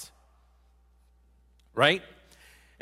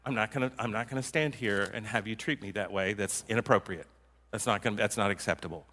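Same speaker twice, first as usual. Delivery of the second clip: low and slow; 5 semitones lower, 6 dB quieter than usual. Shown as a demonstration. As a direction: lower and softer, calm and firm. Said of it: "Low and slow, that's what I always tell you".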